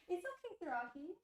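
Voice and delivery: calm the cat down sweetly; soothing pet talk. Real, not acted. Reassuring her stressed cat, high soothing voice.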